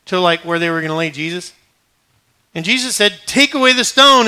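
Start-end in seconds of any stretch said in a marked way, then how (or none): 3.3-4.3 loud, raised voice of command. Acted. Jesus orders the tomb opened, commanding. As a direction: proclaim it as a high, forceful order.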